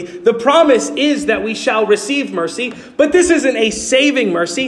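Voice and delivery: usual speaking voice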